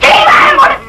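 high, crying shout to shut up; tearful outburst. Acted. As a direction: shriek it through tears at the husband. Wife silences husband in tearful quarrel.

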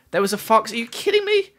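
loud, high-pitched disbelief; incredulous. Real, not acted. Streamer hears of missed fox, high incredulous cry. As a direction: voice shooting up on a shocked question. Chat says a fox was passed.

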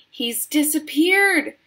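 high, loud, stretched wail; drawn-out high cry; yelled alarm. A narrator's reading. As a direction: yell in motherly panic. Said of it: "yelled Missus Gloop".

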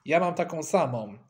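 ordinary delivery, nothing marked

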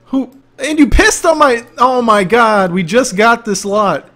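high, very loud appalled rant; hammed-up aghast shouting; mock outrage. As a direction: play it scandalized at full volume. Acts outrage over pissed-on new lot.

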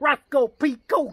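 loud, high American TV twang; mock American accent. Recalling the TV sheriff, loud mock American accent. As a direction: shout it in a put-on American accent. Naming TV sheriff in his style.

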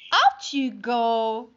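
firm dismissal. Acted. Family throws spider out.

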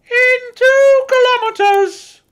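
very high, loud bossy voice; bossy sat nav lady. A narrator's reading. Satnav lady directing Santa, bossy. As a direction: order like a prim satnav. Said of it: "in a bossy lady's voice".

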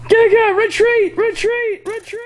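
high shouted retreat, fading out; frantic retreat order. Pretend. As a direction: yell retreat while running off.

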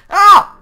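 sudden high, loud "ah"; high-pitched burst of shock; startled yell. Real, not acted. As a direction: yelp in surprise. Startled by a baby tiger.

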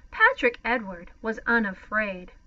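ordinary delivery

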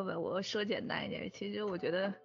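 ordinary talk, nothing marked

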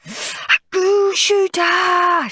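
high, slow, thrilled shout. A narrator's reading. Henry opens the goo shooter, shouting with joy. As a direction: cry out in delight at the gift. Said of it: "shouted Horrid Henry".

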